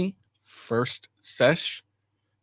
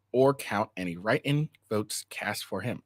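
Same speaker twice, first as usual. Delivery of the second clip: ordinary delivery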